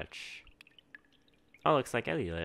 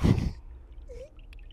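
nervous laugh; 6 dB louder than usual. Real, not acted. Nervous laugh at creepy game.

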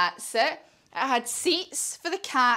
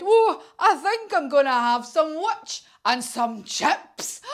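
raised, swooping, gloating voice; hungry dragon; 4 semitones higher, 5 dB louder than usual. Acted. Dragon announces his tea to the witch, greedy relish.

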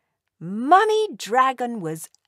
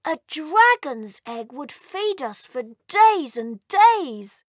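greedy delight; 5 semitones higher, 5 dB louder than usual. Acted. Hungry elf licking his lips.

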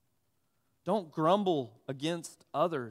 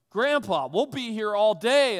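put-on higher, louder childish protest; complaining kid; 5 semitones higher, 7 dB louder than usual. Grandson protesting the weeding job to grandpa, whining higher and louder. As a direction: whine at the size of the job. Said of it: "And I start complaining".

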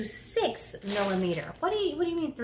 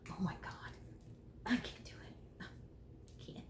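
breathless whispered awe; real overwhelmed whisper; awed whisper; 2 semitones lower, 16 dB quieter than usual. Real, not acted. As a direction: whisper as if too much to handle. Overwhelmed by yarn.

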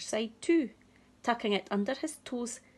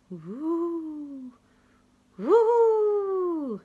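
high loud howling wind; 10 semitones higher, 9 dB louder than usual. Acted. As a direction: howl like the wind. Wind noise.

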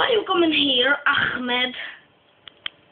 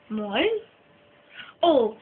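ordinary delivery

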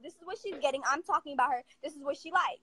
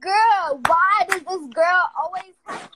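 exasperated outburst; 11 dB louder, slower than usual. Real, not acted. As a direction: let the exasperation burst out. Exasperated rant about a girl.

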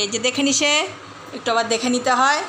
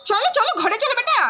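calling the dog; 10 semitones higher than usual. Real, not acted.

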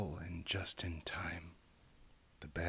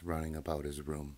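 ordinary delivery

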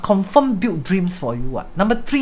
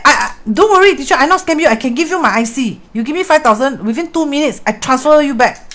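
higher, insistent mock scammer reassuring; imitated scammer; 7 semitones higher, 4 dB louder than usual. Retelling the scammer's promise to viewers, higher mock pleading. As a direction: oversell the trustworthiness like a con man. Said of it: "He said".

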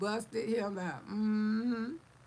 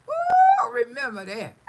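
high, loud whoop; 12 semitones higher, 10 dB louder than usual. Real, not acted. Taunting haters with a high, loud whoop. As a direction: whoop and cackle.

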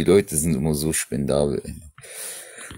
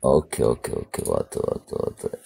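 whispered; 8 semitones lower, 7 dB quieter than usual. Real, not acted. Whispering to himself mid-stream.

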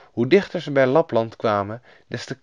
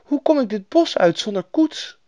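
put-on high voice of a stranded girl; little girl at a loss, pitched high; girl heroine; 14 semitones higher than usual. A narrator's reading. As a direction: ask like a troubled child. Lost Gerda asks for help.

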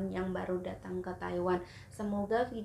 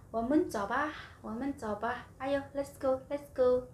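eager urging; 5 semitones higher than usual. Retold or imitated. Urging friends at cafe.

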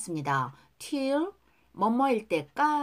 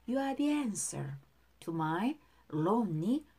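slow, even teacher's model line; slow model reading; 4 dB quieter, much slower than usual. Shown as a demonstration. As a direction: spell each word out slowly. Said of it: "발음 따라할게요". (천천히 또박또박 시범 발음)

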